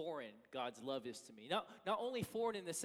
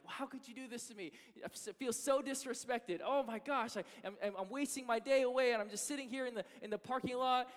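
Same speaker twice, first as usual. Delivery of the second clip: higher, louder hammed-up indignant rant; mock angry rant; 8 semitones higher, 4 dB louder than usual. Pastor retelling his angry texts to his wife, hammed-up indignant rant. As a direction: whine out the grievance in mock outrage.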